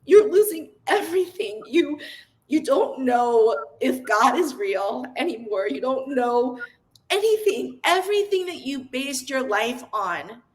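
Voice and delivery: real emotion breaking through as she recalls it; choked-up, heartfelt recollection; getting emotional. Real, not acted. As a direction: speak close to tears, fighting to keep going. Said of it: "sorry, I'm gonna get emotional".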